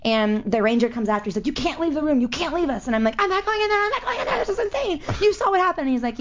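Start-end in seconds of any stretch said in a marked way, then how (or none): 1.5-2.9 lightly voiced urgent ranger, higher pitched; insistent ranger. Ranger calling after a fleeing guest, mildly urgent imitation. 3.2-5.9 very high, frantic retold protest; panicked refusal. Guest refusing the ranger after fleeing the room, high panicked protest.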